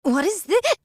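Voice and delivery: high startled question. Acted. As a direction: caught off guard, voice jumping up. Snatched drink discovered.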